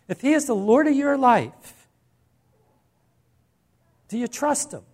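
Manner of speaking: preaching with rising intensity; fervent preaching. Acted. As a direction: push the question home with fervor. Preaching at full force, raised.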